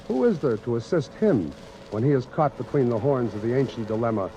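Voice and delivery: usual speaking voice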